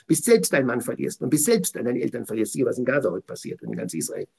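normal talking voice

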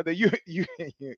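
usual speaking voice

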